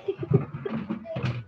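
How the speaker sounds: high, soft burst of giggles; giggling. Real, not acted. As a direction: laugh helplessly, high and soft. Amused at being laughed at. (mahinang hagikhik na mataas)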